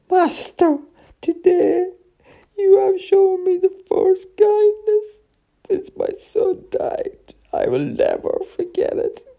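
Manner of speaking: very high, crying gratitude; tearful old woman. A narrator's reading. Old woman at her rescuer's feet, crying. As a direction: weep the words out, high and breaking. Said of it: "She started to cry".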